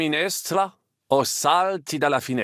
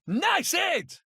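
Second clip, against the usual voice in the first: excited shout; 13 semitones higher, 3 dB quieter than usual. Acted. Cheering a hit.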